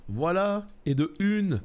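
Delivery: voix haute et contente; triomphant. Real, not acted. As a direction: annoncer la victoire, fier. Réussite dans le jeu. (ton de victoire enjoué)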